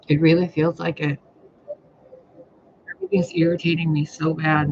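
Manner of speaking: ordinary delivery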